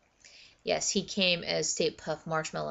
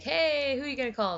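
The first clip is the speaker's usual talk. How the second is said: high, playful imitated call-out; old guy's greeting; 8 semitones higher than usual. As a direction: imitate a delighted doorman. Voicing old guard greeting the costume.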